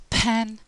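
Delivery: "pen" said alone, loud, clear initial P; teacher modelling "pen" clearly and firmly; minimal-pair demo. Shown as a demonstration. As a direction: isolate "pen" with a crisp P at the start. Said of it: "we can take two words".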